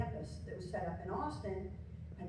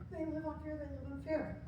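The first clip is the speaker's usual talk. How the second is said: script line; 4 semitones higher, 5 dB quieter than usual. Retold or imitated. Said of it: "the only text in the script".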